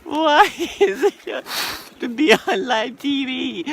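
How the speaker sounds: high, loud wailing whine; snotty crying. Acted. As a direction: wail like freezing and miserable. My nose is running.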